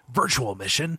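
lightly put-on game hero voice; mock action-hero delivery; Snake impression. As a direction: toss off a bad soldier imitation. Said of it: "when Snake says".

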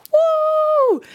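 high, loud woo; excited high whoop; high cheer. Real, not acted. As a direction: whoop for the new season. Host cheering own show.

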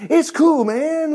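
high-pitched put-on coaxing voice; laid-back false prophet. False prophet lures people to other gods, casually coaxing. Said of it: "if a prophet ever shows up".

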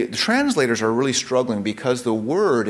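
ordinary talk, nothing marked

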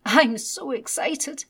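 put-on high, loud, slow excited boy; excited child. A narrator's reading. Little Ted holds his new dinosaur, high loud child's voice. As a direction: gush it like a thrilled small boy. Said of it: "Little Ted said".

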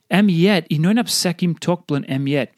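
normal talking voice

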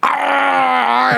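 long, high mock scream of pain; drawn-out high yelp, re-enacted; pained yell. Friend re-enacts having his finger bitten, pained yell.

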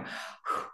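breathy unvoiced sigh of relief; relieved exhale; relieved whew. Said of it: "and I go".

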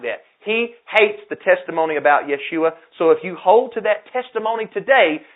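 revival-style preaching; rising sermon fervor. Acted. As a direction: push the congregation with urgency. Preacher building fervor again.

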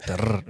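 low, soft trilled R; rolled R. Shown as a demonstration. Showing the friend how they say it, rolled R. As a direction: roll the R on the tongue, low. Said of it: "With that roll the R's".

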